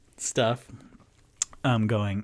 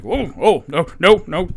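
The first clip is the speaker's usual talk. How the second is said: higher, louder, flustered backing away; hammed-up awkward panic, stomping away; flustered giant; 4 semitones higher, 6 dB louder than usual. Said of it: "stomping like".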